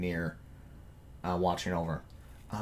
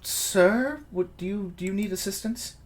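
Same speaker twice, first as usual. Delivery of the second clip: high, courteous servant voice; polite servant, higher; 9 semitones higher than usual. Acted. Servant addresses drunk Rain.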